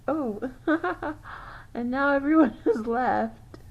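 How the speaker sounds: laughing through the words. Real, not acted. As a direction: break into laughs mid-sentence. Amused at the emptied room.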